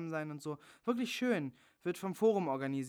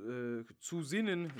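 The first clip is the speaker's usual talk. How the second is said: ordinary talk, nothing marked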